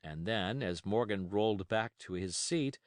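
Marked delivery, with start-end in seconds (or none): none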